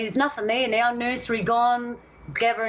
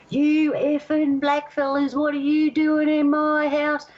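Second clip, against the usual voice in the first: higher, slow furious yell at the intruders; angry ghost yelling; 7 semitones higher, slower than usual. Retold or imitated. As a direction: roar it like an enraged old settler ghost. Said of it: "but you can imagine he's".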